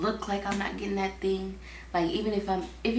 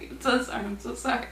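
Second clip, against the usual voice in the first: ordinary talk, nothing marked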